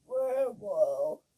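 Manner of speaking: loud, honking goose voice; two loud goose honks; honking geese. Acted. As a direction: honk it out like a goose. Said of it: "honked the geese".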